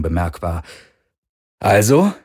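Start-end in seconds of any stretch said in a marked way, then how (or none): 1.6-2.1 cheerful, lifted and louder; upbeat, hiding nerves; good-humoured. A narrator's reading. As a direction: put on a chirpy front. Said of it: "sagte er gut gelaunt".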